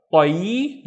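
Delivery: "Tayi" with heavy T and a long rising y glide; held ya. Shown as a demonstration. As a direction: draw out the y at the end of "Tayi". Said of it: "Don't forget to pronounce the ya".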